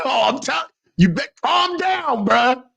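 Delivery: high, slow calm down; exasperated warning. Real, not acted. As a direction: rein the caller in. Host warns caller to stop.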